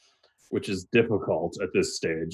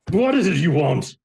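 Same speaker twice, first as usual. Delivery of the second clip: higher, louder furious demand; angry demand; 10 semitones higher, 4 dB louder than usual. Acted. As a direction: snap at the visitors. Angry magistrate confronts party.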